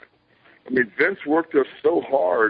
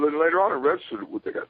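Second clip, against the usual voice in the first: ordinary delivery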